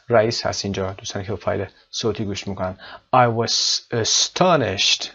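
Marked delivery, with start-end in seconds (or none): 3.1-5.0 astonished with TON louder and drawn out; slow model, TON stressed in astonished. Shown as a demonstration. Teacher reading an answer aloud, stress on TON in astonished. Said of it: "قسمت تا".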